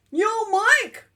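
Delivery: high, loud friendly holler; lizard calling out. Acted. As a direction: hail a buddy loudly. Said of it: "said the lizard".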